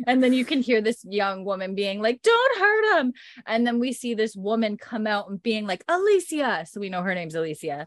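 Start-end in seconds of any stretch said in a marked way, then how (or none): 2.2-3.1 very high, loud cry; a hammed-up frantic shout; mock desperate plea. Young woman begging the shooters, mock desperate cry. 5.9-6.7 high-pitched summoning call. Retold or imitated. Woman coming out after Alicia, calling out. Woman calls the girl sternly.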